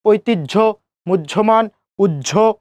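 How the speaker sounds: ঐতিহ্য, মুহ্যমান, উহ্য with a doubled "jjh"; "oitijjho, mujjhoman, ujjho"; j plus jh. Shown as a demonstration. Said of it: "জ plus ঝ উচ্চারণ করছি".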